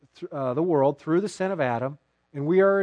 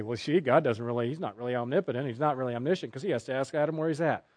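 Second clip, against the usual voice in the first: a touch of smug know-it-all, a bit softer; mocking intellectuals; 5 semitones lower, 8 dB quieter, faster than usual. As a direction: lightly send up the clever critic. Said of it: "when intellectuals try to say".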